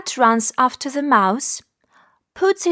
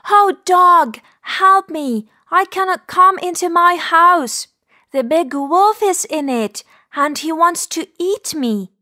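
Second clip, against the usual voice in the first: high, louder plaintive rabbit voice; raised rabbit voice, acted distress; pleading little rabbit; 6 semitones higher, 3 dB louder than usual. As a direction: call for help as a frightened storybook rabbit. Rabbit begs dog for help.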